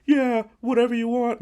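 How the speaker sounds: very high, loud squeal; hammed-up high, eager yelp; mock-crazed agreement. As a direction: play an unhinged prisoner giving in. Acts the broken, rocking prisoner agreeing.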